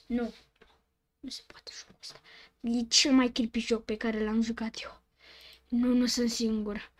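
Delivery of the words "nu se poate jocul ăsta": fast, hushed whisper; spooked whispering; rushed whisper. Real, not acted. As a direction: mutter it fast under your breath. Creepy game reaction.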